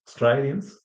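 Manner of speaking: "Australians" in Aussie style, "stray" said as "stry"; Australian accent. Shown as a demonstration. Teacher showing a typical Aussie pronunciation, Australian accent. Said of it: "that's very typical Aussie".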